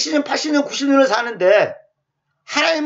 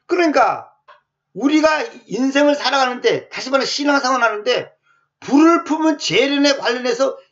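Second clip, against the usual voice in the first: ordinary talk, nothing marked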